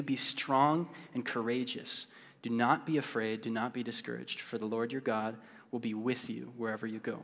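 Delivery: soft, hushed scripture read over music; quiet worship reading. Acted. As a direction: read the promises gently to a praying crowd.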